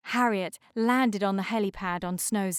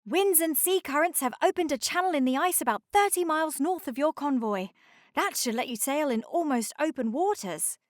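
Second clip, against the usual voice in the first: higher, quick report; lightly voiced helicopter; 6 semitones higher, faster than usual. A narrator's reading. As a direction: rattle off the good news.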